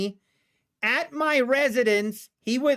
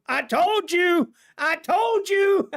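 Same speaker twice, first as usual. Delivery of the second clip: high, drawn-out gloating taunt; mock I-told-you-so; 8 semitones higher, slower than usual. Driver to the trooper who found no drugs, taunting. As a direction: rub it in, slow and high-pitched. Gleefully re-enacting his retort to trooper.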